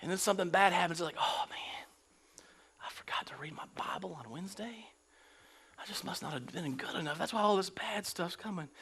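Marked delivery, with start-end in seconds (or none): 1.2-8.7 whispered, much softer worrying; mock guilty whisper; anxious whisper. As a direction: fret under your breath. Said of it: "whispered fearful self-talk".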